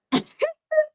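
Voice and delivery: high squealing laugh. Real, not acted. Telling the airport story, a high squealing laugh.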